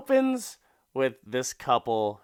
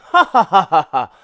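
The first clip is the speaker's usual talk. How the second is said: loud laughter; 7 semitones higher, 14 dB louder, faster than usual. Real, not acted. Host laughing at his own fake commercial, loud. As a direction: burst of real laughing.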